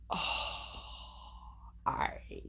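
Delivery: breathy, barely voiced "Oh, all right"; hushed incredulous aside; sarcastic whisper. Real, not acted. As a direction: whisper it with disbelief. Sarcastic reaction to 911 call.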